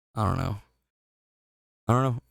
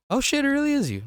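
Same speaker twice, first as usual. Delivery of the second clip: much higher, amazed fan voice; imitating a starstruck fan. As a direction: squeal it like a fan who just recognized him. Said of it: "they always say the same thing".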